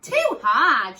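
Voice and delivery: loud, firm complaint; complaining. Acted. Goldilocks rejecting the big bed, loud complaint. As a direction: grumble loudly at the bed. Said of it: "she said".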